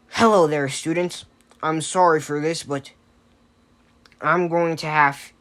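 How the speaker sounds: loud heroic greeting, pitch lifted; big, projected mentor voice; All Might. Acted. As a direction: announce yourself like a confident hero teacher. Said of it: "All Might was standing".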